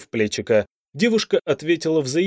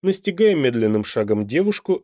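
ordinary delivery, nothing marked

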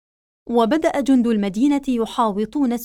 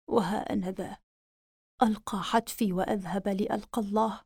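put-on very old faint voice; 4 dB quieter than usual. Dying prince's last words.

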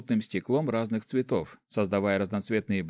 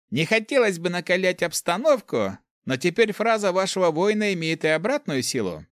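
high, raised narrator's character voice; Руди confronting opponents; 8 semitones higher, 4 dB louder than usual. A narrator's reading. Руди answers the mages' apology with a catch, high and assertive. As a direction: pitch it high and assertive, lightly acted.

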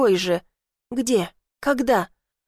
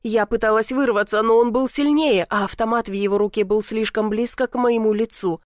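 usual speaking voice

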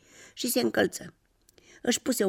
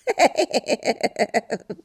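higher-pitched rippling giggle; laugh; 7 semitones higher, 4 dB louder than usual. A narrator's reading. Umbrella recalling the rain, rippling giggle. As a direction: giggle in a long rapid run.

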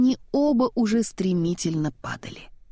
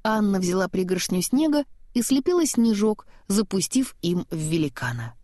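ordinary delivery, nothing marked

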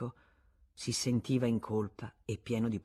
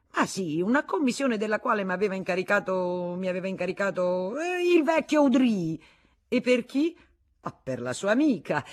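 high, louder excuse; blustering; 6 semitones higher, 7 dB louder than usual. Acted. Husband inventing the errand, blustering. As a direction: keep the lie going.

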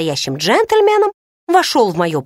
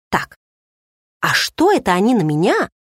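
usual speaking voice

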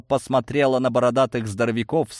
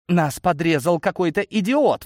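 higher, outraged complaint; indignant; 7 semitones higher than usual. A narrator's reading. Semyon after being cut off on the road, indignant. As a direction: snap it out, pitch raised in outrage. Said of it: "возмутился Семён".

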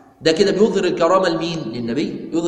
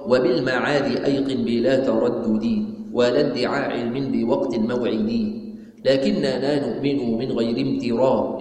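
measured, drawn-out chanting of the poem; slow verse recitation; 4 semitones lower, much slower than usual. Acted. Teacher reciting the creed poem to students, slow chant. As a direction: give each line of the poem its full slow weight.

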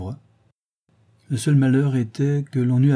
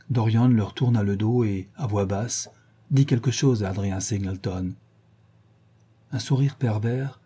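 usual speaking voice

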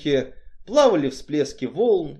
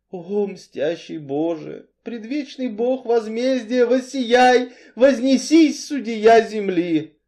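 high, sustained invocation swelling louder; fervent prayer; 9 semitones higher, 5 dB louder, slower than usual. A narrator's reading. Cain prays to the avenging God, fervently. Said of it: "молился Каин".

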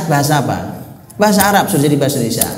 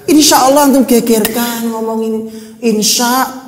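mocking; 8 semitones higher, 5 dB louder, slower than usual. Mocking quarrelling about spelling.

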